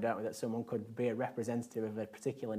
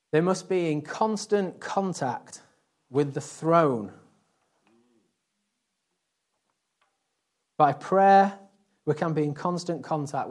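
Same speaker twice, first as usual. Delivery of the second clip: slow, loud and higher, every word hammered; preaching at full force; 3 semitones higher, 8 dB louder, slower than usual. Acted. Preacher to congregation, slow and loud proclaiming. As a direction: proclaim it from the pulpit.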